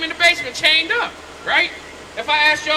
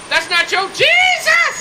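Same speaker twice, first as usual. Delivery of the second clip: a slow, drawn-out shout with a sharp pitch jump; 13 semitones higher, 4 dB louder, slower than usual. Real, not acted. Preacher confronting a passerby over the picture, shouting. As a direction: hurl it at him, slow and high.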